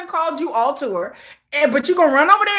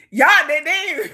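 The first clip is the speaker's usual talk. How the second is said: normal talking voice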